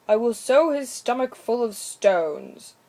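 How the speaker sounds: deliberate punishing resolve; stern woodcutter. A narrator's reading.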